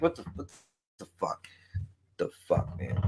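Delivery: barely audible, half-voiced grumble; muttered under breath. Real, not acted. Streamer grumbling to himself, muttered under breath. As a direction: swear to yourself so the chat can't quite hear.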